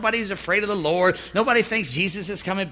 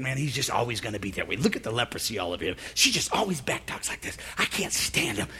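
muttered grumbling; 10 semitones lower, 9 dB quieter than usual. Retold or imitated. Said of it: "You get to thinking".

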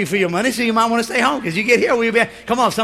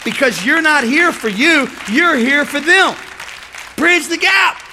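louder and higher, drawn out slowly; rising preaching climax; 6 semitones higher, 6 dB louder, slower than usual. Real, not acted. Preacher driving his point home to the congregation, rising loud. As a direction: build it to a ringing climax.

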